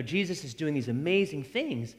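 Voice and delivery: normal talking voice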